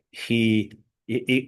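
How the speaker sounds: usual speaking voice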